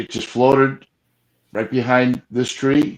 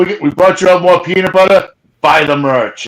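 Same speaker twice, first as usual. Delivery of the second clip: loud, raised-pitch mock send-off; calling out; 6 semitones higher, 5 dB louder than usual. Investigator calling out as he drives away, mock send-off shout. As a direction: shout it cheerily after them. Acting out shouts to departing friends.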